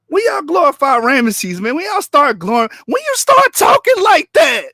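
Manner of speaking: building shout. Real, not acted. Streamer calls out opponents, building to a shout. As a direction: build it up until you're yelling.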